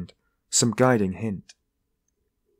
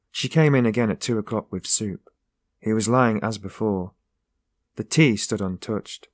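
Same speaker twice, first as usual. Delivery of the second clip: ordinary delivery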